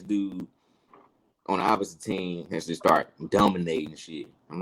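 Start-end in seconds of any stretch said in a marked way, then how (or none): none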